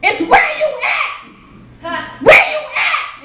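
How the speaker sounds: high, slow shouted correction; frustrated shout. Retold or imitated. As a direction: yell back in exasperation. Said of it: "I was getting so frustrated".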